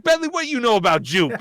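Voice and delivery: high, loud imitation of a challenging wife. Wife challenges the gift-giver about cologne, loud high impression. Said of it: "and his wife's just like".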